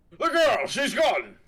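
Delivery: high, loud shocked cry; acted alarm. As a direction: exclaim in hammed-up shock. Game character shocked at disappearance.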